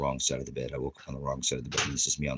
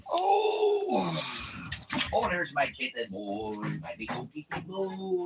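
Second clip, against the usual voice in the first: cooing to pet; 10 semitones higher, slower than usual. Real, not acted. Greeting his big old kitten boy, cooing to pet. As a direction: coo high and drawn-out, adoringly.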